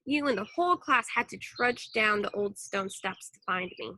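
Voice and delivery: plain reading voice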